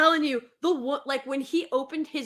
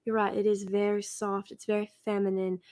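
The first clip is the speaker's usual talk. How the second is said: Deep South cotillion accent; 3 semitones lower than usual. Shown as a demonstration. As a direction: demonstrate a lulling, unhurried cotillion Southern accent. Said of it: "let me see if I can".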